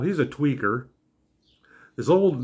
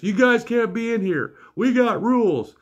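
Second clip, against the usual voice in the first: mock scream, pitched way up; retold screaming; 9 semitones higher than usual. Carnival worker screaming at other parents, high mock yell. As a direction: imitate an indignant carnival worker hollering. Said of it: "screaming at the other parents".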